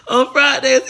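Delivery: laughing through the words, pitch jumping high; slow, high giggly repeat. Real, not acted. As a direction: breaks into giggles mid-phrase. He's sitting up here laughing.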